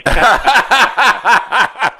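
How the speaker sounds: a bright burst of real laughter, pitched high. Real, not acted. Caller laughing at the host's Southern drawl. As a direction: let a genuine laugh break out.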